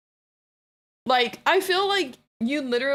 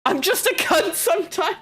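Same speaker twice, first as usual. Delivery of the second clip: higher, louder playful yell; self-mocking shout; 7 semitones higher, 3 dB louder than usual. Real, not acted. Streamer to chat, self-mocking shout. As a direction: proudly shout the confession.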